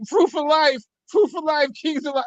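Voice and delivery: high mocking voice quoting Flip's panic. Mocking a friend's frantic proof of life call, high. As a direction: squeak out the proof-of-life demand. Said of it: "Flip was like".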